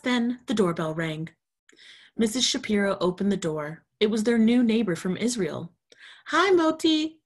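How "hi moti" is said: high, loud happy greeting; exclaiming. Acted. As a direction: greet him with delight. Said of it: "she exclaimed".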